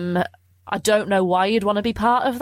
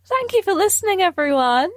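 higher, sing-song sign-off; 11 semitones higher than usual. Pretend. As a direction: chirp it up high. Sign-off redone, much higher.

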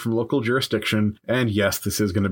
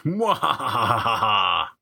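evil cackle; much slower than usual. Pretend. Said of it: "is what the lepers were supposed to be saying".

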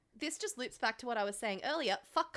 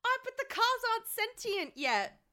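excited protest; 11 semitones higher, 3 dB louder than usual. Real, not acted. Excited protest through laughter.

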